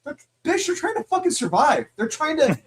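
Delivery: high-pitched indignant comeback; exasperated retort. Acted. Reader talking back to the lecturing teen, exasperated retort. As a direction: argue back in exasperated disbelief.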